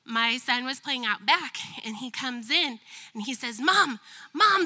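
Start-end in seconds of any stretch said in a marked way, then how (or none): 3.6-4.6 put-on calling out, higher and louder, like a worried kid; a child rushing in to alert mom; excited child calling. Child calling mom about birds.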